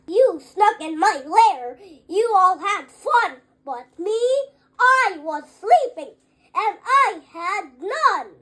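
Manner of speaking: loud, angry bear voice. Acted. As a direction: roar out the complaint. Woken bear scolds the guests.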